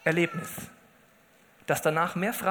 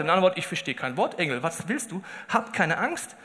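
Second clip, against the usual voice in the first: ordinary talk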